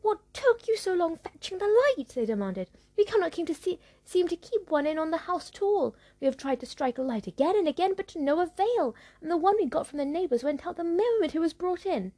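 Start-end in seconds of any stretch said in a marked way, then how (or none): 0.0-2.1 much higher, louder nagging sisters voice; scolding stepsisters. Acted. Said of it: "chide and scold her". 3.0-12.1 higher, louder complaining sisters; scolding stepsisters. Acted. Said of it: "chide and scold her".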